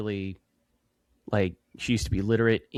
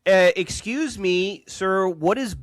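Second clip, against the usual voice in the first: higher, louder, slow dumb tourist voice; mock clueless tourist; 7 semitones higher, 5 dB louder, slower than usual. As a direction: ham up a clueless tourist asking, slowly. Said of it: "sitting here like a fucking idiot going".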